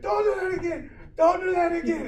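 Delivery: high, loud, hammed-up protest; pleading old man. Old man begging the medic after shock, pleading.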